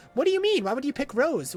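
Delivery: high-pitched mimicked reproach; mock indignation. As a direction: imitate a game character's outraged questioning. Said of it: "They said".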